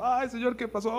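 high, hammed-up wail; mock crying. Preacher imitating a believer crying to the Lord, mock wail. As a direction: imitate a tearful plea to God. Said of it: "luego estás ahí llorando".

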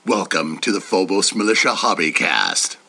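very low, heavy hero voice; deep Batman voice. Shown as a demonstration. Perfect take of the podcast intro as Batman. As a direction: sink deep into the words. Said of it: "deep Batman voice".

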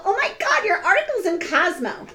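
very high, fake-thrilled squeal; mock gushing. Writer's friend at a Cosmo reading party, mock gushing. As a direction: gush like an impressed friend, hammed up.

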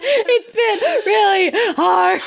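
high, slow whine broken by laughter; hammed-up self-pity while laughing; laughing mock whine.

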